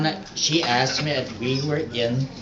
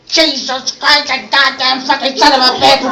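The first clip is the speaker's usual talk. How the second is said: put-on boy proudly swearing; 10 semitones higher, 8 dB louder than usual. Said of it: "I began to share them".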